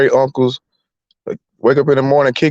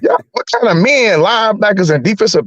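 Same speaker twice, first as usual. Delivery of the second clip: disbelieving retort; 6 semitones higher than usual. Retold or imitated. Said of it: "I said".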